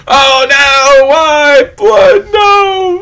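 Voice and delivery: high, loud hammed-up crying out, ending in a laugh; imitating loud wailing. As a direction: wail it out like a mourner, then laugh. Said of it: "It's, / that type of weeping".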